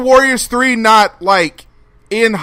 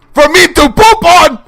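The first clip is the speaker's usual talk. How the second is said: loud, high, put-on puppet bark; hammed-up insult comic punchline; mock insult comic; 7 semitones higher, 7 dB louder than usual. Host closing the topic with a joke, loud put-on voice. Triumph insult dog bit.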